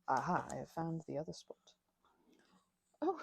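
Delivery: usual speaking voice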